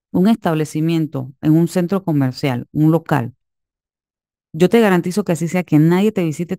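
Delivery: ordinary talk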